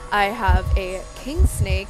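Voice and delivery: ordinary talk, nothing marked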